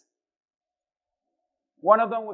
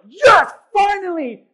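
much higher, loud, slow triumphant shout; excited cheer; 11 semitones higher, 15 dB louder, slower than usual. Retold or imitated. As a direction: yell it like you finally won. Retold joy at being chosen.

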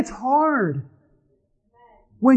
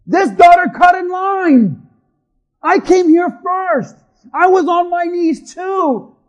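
loud, higher-pitched aggrieved complaint; acted outraged objection, voice raised; indignant protest; 5 semitones higher, 6 dB louder than usual. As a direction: protest loudly that you were cheated of your turn. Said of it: "Jairus is like".

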